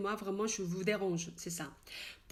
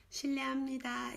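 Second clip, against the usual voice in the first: 실례합니다 quick, h dropped, shileamnida; 실례합니다 fast and casual; fast natural; 4 semitones higher, much faster than usual. Shown as a demonstration. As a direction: say 실례합니다 at everyday speed.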